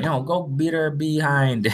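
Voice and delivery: quoting Clarissa. Retold or imitated. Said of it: "she was like".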